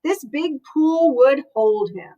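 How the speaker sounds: ordinary delivery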